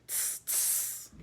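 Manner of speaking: soft unvoiced snake hiss. A narrator's reading. Kaa the python hissing at the bear and panther. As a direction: hiss through the teeth like a snake. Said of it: "said Kaa".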